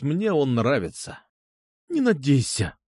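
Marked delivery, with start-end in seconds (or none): 1.9-2.8 tight, understated refusal; through gritted teeth. A narrator's reading. Girl rejecting her suitor, through gritted teeth. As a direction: squeeze the words out through clenched teeth. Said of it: "процедила она сквозь зубы".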